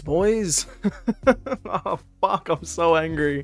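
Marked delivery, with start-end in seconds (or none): 0.7-1.6 high, disbelieving laugh; exasperated laugh. Real, not acted. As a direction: laugh it off in frustration. Laughs at own misfortune.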